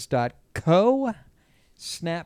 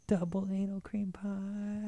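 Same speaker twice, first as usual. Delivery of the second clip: whispering; 6 semitones higher, 11 dB quieter, slower than usual. Acted. Teasing whispered title.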